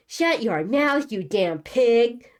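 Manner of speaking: slow, loud, high-pitched furious retort; angry snapping. A narrator's reading. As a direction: spit the insult out, drawing each word. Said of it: "Alissacles snapped".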